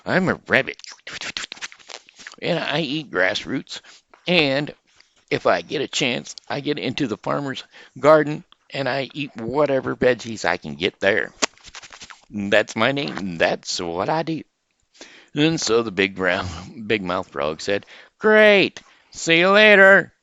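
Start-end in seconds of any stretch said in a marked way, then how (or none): 0.0-14.4 rabbit voice. Acted. Rabbit answers frog. 18.2-20.1 high, loud, booming big-mouth frog; high, loud frog shouting hello to the rabbit. Acted. Frog says goodbye.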